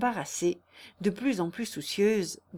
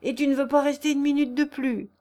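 higher, louder complaint; acted despairing protest, pitched up; exasperated suitor; 6 semitones higher, 6 dB louder than usual. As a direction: throw up the question in frustration. Léon exasperated.

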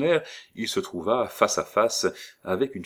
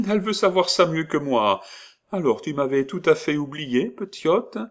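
put-on higher, louder fond old woman; 9 semitones higher, 5 dB louder than usual. Doting old woman teases the little girl, higher voice. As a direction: tease the child like a doting granny.

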